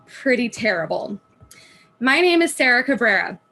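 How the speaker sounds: normal talking voice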